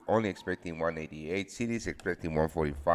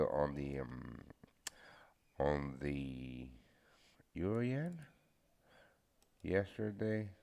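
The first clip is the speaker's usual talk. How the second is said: just woke up; 2 semitones lower, 5 dB quieter, slower than usual. Real, not acted. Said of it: "sorry, I just woke up".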